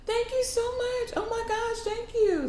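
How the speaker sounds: gasp, then very high squeal of thanks; hammed-up kid's delighted gratitude; thrilled little boy. Boy handed a candy bar, high-pitched overjoyed thanks.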